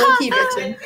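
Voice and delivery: squeaked high and tight; high squeaky voice. Pretend. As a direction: squeak it out like a joke. Playful quip repeated.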